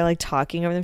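ordinary talk, nothing marked

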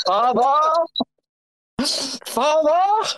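booming hammed-up cry of Father; mock Matt Berry bellow; Matt Berry impression. Ode to Matt Berry, a hammed-up booming call. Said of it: "my ode to Matt Berry".

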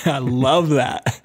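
slow, laughing appreciation; laughing delight. Real, not acted. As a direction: savour it through real laughter.

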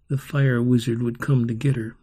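ordinary delivery

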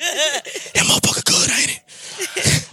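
sex whisper. Retold or imitated. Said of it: "you whisper".

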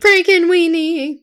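high, loud, slow squeal; delighted shout of recognition; excited. Real, not acted.

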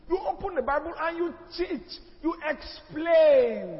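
slow, loud, drawn-out high call; preacher's slow ringing command; emphatic preaching. Real, not acted. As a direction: hammer the point home to the congregation. Preacher urging teaching over cursing.

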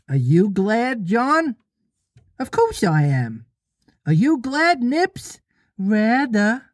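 put-on high, piping boy voices; lost boys asking in turn. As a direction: trade questions like eager little children.

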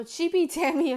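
ordinary talk

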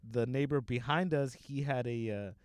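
normal talking voice

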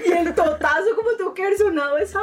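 picture the fall and crack up; laughing disbelief. Real, not acted.

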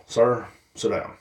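slow, firm command; slow stern order. Acted. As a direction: put him in his place slowly. Telling the TikTok guy off.

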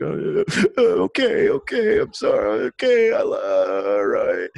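hammed-up tearful wail, pitch leaping about; mock sobbing. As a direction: imitate a crying kid giving in. Imitates own tearful reply, crying earlier.

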